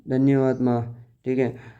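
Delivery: normal talking voice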